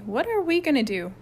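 high, anxious question; nervous. A narrator's reading. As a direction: ask uneasily for guidance. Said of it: "said Mike nervously".

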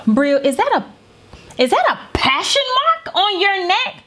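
slow, suspicious and pointed, like a mother who already knows; mock interrogation. Mother grilling her daughter about the hickey, drawn-out and accusing.

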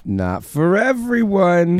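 slow, high title announcement; announcer voice. Acted. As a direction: announce the show name grandly.

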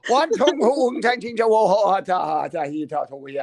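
hammed-up mock Chinese babble; comic fake-Chinese gibberish, run together. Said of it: "trying to think of words in Chinese".

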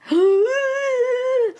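long, very high mock squeal; high freak-out squeal. Woman re-enacts getting back to the room, high freak-out squeal. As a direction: squeal as if silently bursting with excitement.

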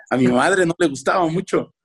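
usual speaking voice

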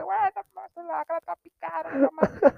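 high, frantic warning cry; panicked yelling. Retold or imitated. Warning his friend about the scorpion, frantic high yell. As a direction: yell at your buddy in alarm.

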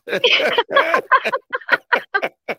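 loud, high burst of laughter; real big laugh. Real, not acted. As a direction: crack up at being teased. Laughs at Simpsons comparison.

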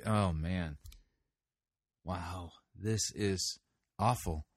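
low, soft and slow disbelief; quiet groan of dismay. Real, not acted. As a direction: sink into disappointment watching it. Critic reacts in dismay.

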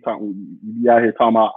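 normal talking voice

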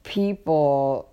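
ordinary talk

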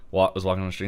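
ordinary talk, nothing marked

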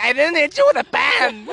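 high, re-enacted protest with a laugh; hammed-up self-defence; indignant denial. Acted quarrel, denial.

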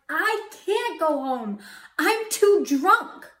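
put-on loud, high, dragged-out drunken whine; drunken protest. Drunk driver answering the mysterious voice, slurry loud protest. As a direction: stretch the words like a protesting drunk. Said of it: "I'm still really high, really drunk".

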